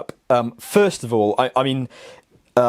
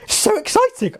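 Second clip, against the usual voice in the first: excited. Real, not acted. Gushing about signing an NDA.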